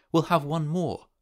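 high, eager triumph; triumphant. A narrator's reading. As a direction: urge him on with rising excitement. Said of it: "she cried triumphantly".

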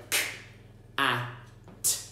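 K, a, t each sound alone with gaps. Shown as a demonstration. Parent sounding out cat for child, separated sounds. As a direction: segment cat sound by sound with pauses. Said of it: "Leave a little gap".

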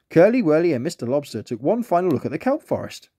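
usual speaking voice

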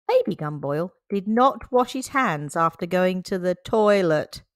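usual speaking voice

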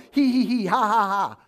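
high, loud hee hee ha ha; loud mocking cackle; mock silly laughter. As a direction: ham up a fake sitcom laugh.

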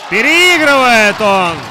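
high, loud, slow commentator cry; excited commentator call. Real, not acted. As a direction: stretch it out over the crowd. Commentator calls a goal.

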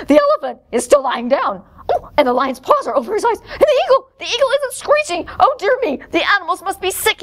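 high, flustered fretting; alarmed zookeeper. Acted. Zookeeper thinking the animals are sick, alarmed.

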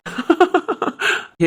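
high, light laugh; amused laugh. Acted. Husband amused by his wife's scolding, high laugh.

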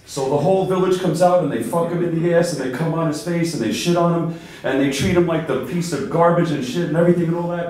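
ordinary delivery

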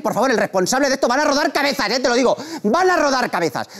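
high-pitched scandalised scolding; theatrical indignation, pitched high; mock outrage. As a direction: rant like an appalled host, hammed up. Mock outrage at naked man.

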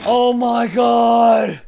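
slow, drawn-out loud cry of dismay; stretched despairing yell after dying; anguished shout. Real, not acted. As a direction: wail it out long. Dies just short of goal.

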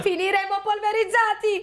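high, alarmed cry; bear yelling. Acted. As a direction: cry out that disaster is coming. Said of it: "Urlò l'orso".

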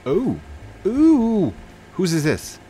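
high delighted ooh; sudden excited gasp; surprised. Real, not acted. Spots a player.

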